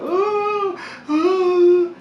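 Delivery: high, loud mock child wail; high crying imitation of a sobbing daughter; imitating daughter crying. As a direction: wail like a little girl in tears. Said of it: "crying hard as hell".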